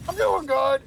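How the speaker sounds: high-pitched, put-on character voice; voiced game character. Retelling a game character's replies to friendly questions, high-pitched put-on voice. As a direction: answer in a squeaky-high little voice. Graw's acted replies in retold scene.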